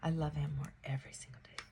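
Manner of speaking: whispered, quick and tender. Shown as a demonstration. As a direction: breathe it as a secret. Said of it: "whispered repeat".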